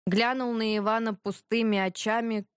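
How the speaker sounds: ordinary delivery, nothing marked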